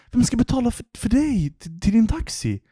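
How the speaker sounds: high falsetto bewildered whine; puzzled drunk woman asking who pays, in a squeaky high voice; confused drunk woman. Retold or imitated. Mimicking the confused drunk passenger, high falsetto. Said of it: "Hon bara".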